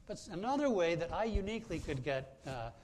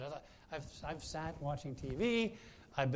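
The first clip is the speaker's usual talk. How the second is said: normal talking voice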